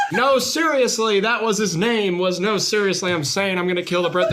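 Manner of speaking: high-pitched mock protest; hammed-up desperate explaining; protesting announcer. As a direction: plead your innocence while being hauled away. Announcer protests while being dragged off.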